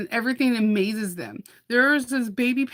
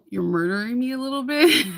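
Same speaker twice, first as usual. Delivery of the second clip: mock complaint; 4 semitones higher, 3 dB louder than usual. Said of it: "and I'm just like".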